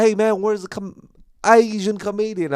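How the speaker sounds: high-pitched Southern twang; bad Southern accent. Southern fan looking for the comedian, in a bad Southern accent. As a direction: put on a broad, badly faked Southern accent. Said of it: "Southern accent".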